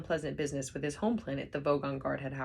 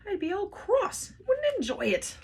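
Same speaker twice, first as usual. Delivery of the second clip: high, loud, whiny panic; much higher loud frantic whine; panicked Arthur; 6 dB louder than usual. A narrator's reading. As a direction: wail it like a frightened man. Arthur panicking, voiced distinctly.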